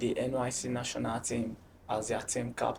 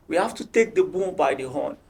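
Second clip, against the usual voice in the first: raised voice, imitating the coach's rallying call; louder, urging like a coach; coach's shout; 3 semitones higher, 5 dB louder than usual. Coach rallying his team before the league, shouting. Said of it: "He will, like, shout".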